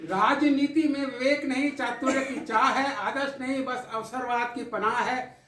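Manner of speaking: measured, dramatic poetic recital with held pauses; poem recitation. Acted. Poet recites tribute to the audience, in recital cadence. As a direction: recite it like a poet at a memorial gathering.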